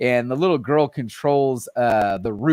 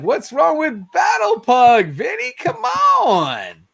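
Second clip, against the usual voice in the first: mock-offended shout at a friend; mock outrage; 14 semitones higher, slower than usual. Host playfully defends Battle Pug to Vinny, mock-offended shout.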